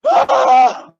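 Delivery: loud, hammed-up frightened "ah"; short scream. Scared searcher after missing dog, short loud scream. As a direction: let out a sudden scream.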